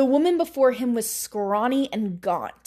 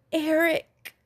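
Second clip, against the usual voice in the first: tearful; 6 semitones higher than usual. A narrator's reading. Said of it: "a tear rolling down".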